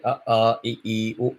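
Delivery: short a, i, u, each one beat; short vowels. Shown as a demonstration. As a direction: chant a, i, u short and clipped. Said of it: "A is one matra".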